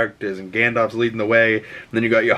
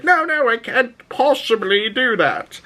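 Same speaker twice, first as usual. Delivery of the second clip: much higher, prissy put-on refusal; fancy guy refusing; 12 semitones higher than usual. Doc refusing the driver's chicken, prissy put-on voice. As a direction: decline like a fussy gentleman. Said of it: "He's like".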